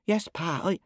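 put-on high child's voice; high-pitched little son replying; little boy. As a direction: answer Pa as a small boy. Little boy answers, is cut off.

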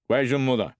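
domineering father calling into darkness; father's loud demand. Acted. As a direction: demand to know which son it is. Father questions boy loudly.

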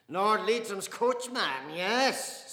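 slow, loud, high sneer. Acted. Father mocking a churchman, slow sneer. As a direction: drag out the insult.

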